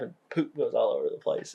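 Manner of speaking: ordinary delivery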